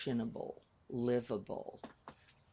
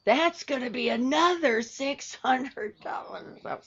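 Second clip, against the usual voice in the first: much higher, louder mock-shocked mother; mom's shocked voice; 12 semitones higher, 10 dB louder than usual. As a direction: squeak it out like a startled mom. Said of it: "And she says".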